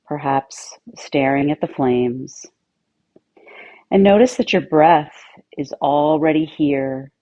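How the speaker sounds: calm, even meditation voice with long pauses; guided meditation. Acted. Yoga teacher settling listeners into meditation, calm guided-meditation delivery. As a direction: settle listeners in with measured, steady phrases.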